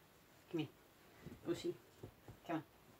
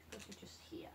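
ordinary talk, nothing marked

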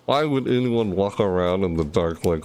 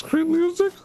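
squeaky high voice. Real, not acted. Surprised by distant sound.

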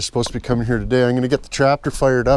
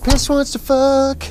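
"Piss wants to fuck" said much higher and slow; silly high voice; 11 semitones higher, slower than usual. Pretend. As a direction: drawl it in a goofy high voice.

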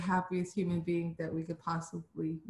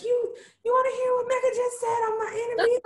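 much higher, louder squeal of gossip; gossipy high voice; 8 dB louder, faster than usual. Pretend. Friend calling Sheila to spill the news, high squeal. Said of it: "call Sheila and be like".

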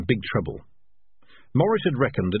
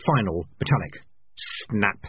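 usual speaking voice

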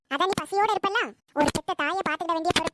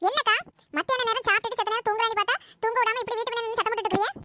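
irritated scolding; 6 semitones higher than usual. Acted. Woken neighbour scolds the wailer.

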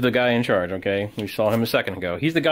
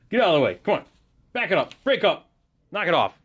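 soldier barking orders; 6 semitones higher, faster than usual. Acted. Soldier breaking up the fight.

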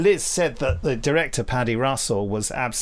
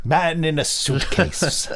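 higher, slow put-on voice; mock title; 6 semitones higher, slower than usual. Host jokes in a slow announcer voice.

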